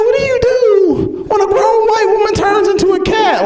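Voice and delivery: very high, squealed despair; hammed-up helpless squeal; bewildered panic. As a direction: wail the question way up high. Acted bewildered reaction to the meowing.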